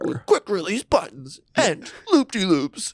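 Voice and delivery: commercial voice. Pretend. Mock toy commercial.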